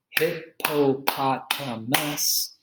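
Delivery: hippopotamus drawn out slowly beat by beat; slow syllable clapping. Shown as a demonstration. Teacher claps out hippopotamus slowly for students. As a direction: break hippopotamus into slow, separate beats. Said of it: "clapping syllables demo".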